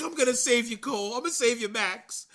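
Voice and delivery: high, loud hammed-up hero; heroic mock rescue cry. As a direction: shout it like a cartoon hero. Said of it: "he's like".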